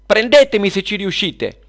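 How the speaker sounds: high, loud daring shout; yelled taunt. Acted. Luna baits the two witches to chase her, yelling. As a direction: yell it as a challenge to pursuers. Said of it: "Spalancò la porta e urlò".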